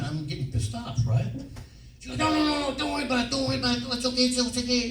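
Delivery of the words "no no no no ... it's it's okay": high-pitched, hurried mock reassurance; placating wardrobe girl. Wardrobe girl calming the annoyed actor, high placating mock voice. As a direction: imitate her fussing to calm you, pitched way up.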